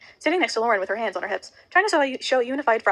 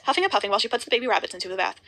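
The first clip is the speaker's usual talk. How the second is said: plain reading voice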